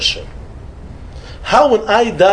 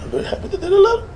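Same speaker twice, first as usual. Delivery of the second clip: much higher, fast, laughing through the words; laughing tease; 12 semitones higher, 5 dB quieter, faster than usual. Retold or imitated. Rabbi teasing the passenger about his wife, laughing. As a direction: tease him while cracking up.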